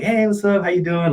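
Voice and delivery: breezy hello hiding sadness; fake cheerful. Greeting family at gatherings while hiding heartbreak, fake cheerful. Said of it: "Like, wearing the mask".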